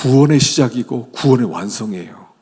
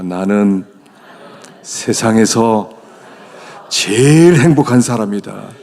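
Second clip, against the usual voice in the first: slow, louder confident declaration; 2 semitones lower, 5 dB louder, slower than usual. Shown as a demonstration. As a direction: say it with full conviction. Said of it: "자신이 없는 것 같아. 다시 한번 해보십시다".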